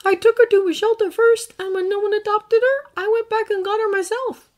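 put-on earnest boy, gently recounting; young hero voice. Izuku continues.

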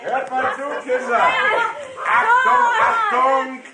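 high, loud announcing call. Acted. The father calling the children over for big news, high and loud. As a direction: summon the whole house to attention.

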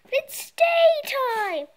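put-on high, loud, slow kid wail; whiny kid. Child protesting to his father. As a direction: drag it out like a stubborn child.

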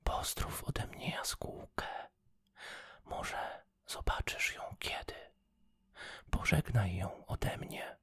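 soft whispered goodbye, a touch of tears; tearful whisper to a little flower; whispering through tears. Acted. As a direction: barely breathe the words out, close to crying. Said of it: "szeptała za łzami".

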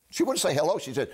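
ordinary delivery

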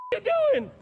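loud, high, alarmed question; startled cry. Real, not acted. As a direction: shriek it in sudden surprise. Startled while being restrained.

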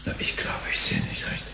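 unvoiced whisper, hushed shock; whispered disbelief. Reginald stares at the empty account, whispering in shock. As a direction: breathe it out, can't believe the screen.

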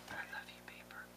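very soft, intimate whisper; barely audible, tender hush; loving whisper. Real, not acted. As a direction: whisper sweetly to a treasured thing. Talks lovingly to the paper.

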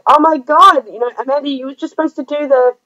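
mock-scold Amanda with delight; retold excited protest. Telling Amanda she did too much, delighted protest. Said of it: "I'm on the other end of the camera going".

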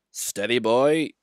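slow, calming word to engine; steadying. Acted. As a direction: settle him down gently. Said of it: "he said".

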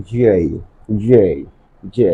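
J drawn into a gliding "jey"; correct letter J. Shown as a demonstration. Teacher modelling British-American pronunciation, J as gliding "jey". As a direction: say each J separately, ending in an "i" glide. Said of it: "according to the rules of correct pronunciation".

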